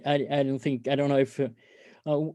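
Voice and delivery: normal talking voice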